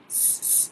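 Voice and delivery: "S," as a soft long hiss; teacher isolating the s sound; isolated s sound. Shown as a demonstration. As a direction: hiss the "S," quietly. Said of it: "don't sound the same".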